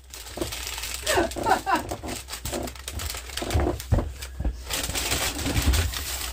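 long airy giggle, pitched well up; long breathy giggle. Real, not acted. Wife giggling at her husband's excuse, long high breathy laugh.

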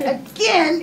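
ordinary talk, nothing marked

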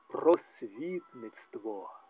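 ordinary talk, nothing marked

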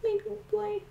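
doting pet-owner coo. Real, not acted. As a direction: coo it way up high to the bunny. Cooing to pet rabbit.

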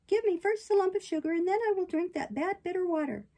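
high, insistent puppet voice; bargaining puppet. Acted. Puppet bargaining with the fairy for sugar, insistent.

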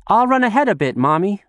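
put-on high, loud little girl's call; girl calls back to her mother, high and loud. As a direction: shout back while running off. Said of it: "Nozomi called back as she ran".

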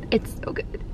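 whispering. Real, not acted. Savoring the drink.

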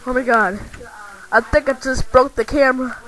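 ordinary delivery

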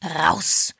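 short, sharp angry order; hissed. A narrator's reading. Mr Parr holds the door for the visitors, hissing. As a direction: hiss it as a command. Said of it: "zischte Mister Parr".

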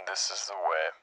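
soft filtered helmet voice; quiet masked bounty hunter; Mandalorian voice. Pretend. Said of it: "the Mandalorian voice".

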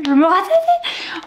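high, laughing quote. Real, not acted. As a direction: squeak it out through laughter. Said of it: "Il a dit quoi déjà plus?". (en riant, voix aiguë)

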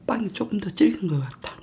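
chewing while talking; speaking between bites; mouth full of bagel. Real, not acted. Seen 2: Bagel in hand, cheeks full, jaw working between bites throughout.

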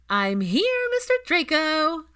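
put-on loud, high child's shout of greeting; excited little boy. A narrator's reading. Albus bursting in to greet Draco, loud high excitement. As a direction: burst in thrilled to see him.

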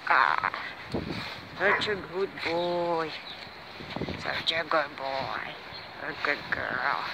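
talking to a dog. Real, not acted. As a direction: praise the dog gently. Praat lief tegen hond. (zacht en lief tegen de hond)

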